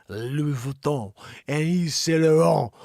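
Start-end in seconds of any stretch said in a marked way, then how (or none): 0.1-1.1 slow French "Louis Vuitton" with nasal final vowel; French pronunciation. Shown as a demonstration. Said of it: "that's exactly how they say it". 1.9-2.7 exaggerated French "Saint Laurent", final consonants silent; hammed-up French "Saint Laurent" with a nasal ending; French pronunciation. Shown as a demonstration. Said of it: "that's exactly how they say it".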